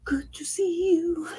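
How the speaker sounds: slow airy whisper of a greeting; hushed playful whisper. Pretend. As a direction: breathe it softly, no voice.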